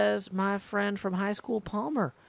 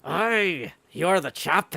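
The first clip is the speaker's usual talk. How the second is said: plain reading voice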